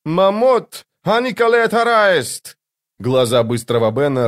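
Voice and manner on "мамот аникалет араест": high, drawn-out yell at someone; acted high scream. A narrator's reading. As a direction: yell the foreign words in a high-pitched voice. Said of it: "Она заорала на старика".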